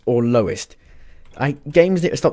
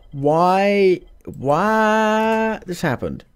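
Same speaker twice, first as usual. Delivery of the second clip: long, slow, much higher wail; despairing whine; 6 semitones higher, much slower than usual. Real, not acted. Streamer moaning at his buggy game in a slow high wail. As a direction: stretch it out in despair.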